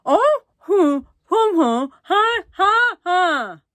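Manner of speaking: high, loud garbled yell without teeth; toothless yell. A narrator's reading. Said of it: "yelled the boy".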